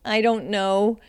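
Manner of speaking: ordinary talk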